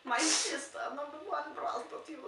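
high mock Kazakhstani accent; hammed-up character voice; Borat. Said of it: "like Borat style".

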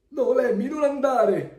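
high, loud storybook yell; alarmed shout. A narrator's reading. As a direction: cry out to stop a friend. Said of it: "gridano i suoi amici". (grido acuto e allarmato degli amici)

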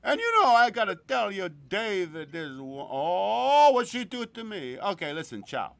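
loud gushing then abrupt ciao; a hint of a smitten friend; imitating Gino. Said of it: "something he would do".

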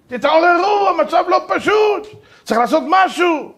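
high, loud, slow alarmed plea; frantic philosopher urging them to act; urgent shout. Retold or imitated. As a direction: yell that action is needed.

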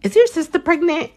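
high, far-off voice for the asker; high imitated adult asking; high quoted question. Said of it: "So they asked Reina".

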